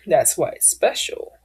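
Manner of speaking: usual speaking voice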